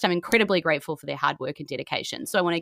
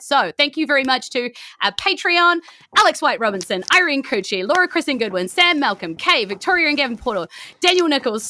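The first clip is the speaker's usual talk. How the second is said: bright, raised roll call of names; lively name roll call; 6 semitones higher, 4 dB louder than usual. Acted. Host reading out the Patreon list, raised and lively. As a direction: rattle off the names with energy.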